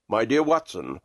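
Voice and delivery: low-voiced, composed detective; Holmes, composed. A narrator's reading. Holmes to Watson as he comes round from fainting, low and composed. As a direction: drop low for the detective's greeting.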